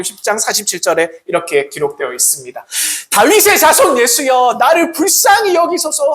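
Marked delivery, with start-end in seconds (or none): 3.1-6.1 high, loud desperate plea; crying out. Acted. Blind beggar crying out to Jesus, loud plea. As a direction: cry out to Jesus for mercy. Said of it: "그는 반복해서 외쳤습니다".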